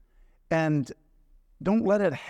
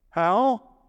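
put-on small boy's question; 7 semitones higher, 5 dB louder than usual. Boy answers mother timidly.

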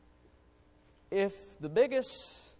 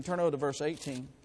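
ordinary talk, nothing marked

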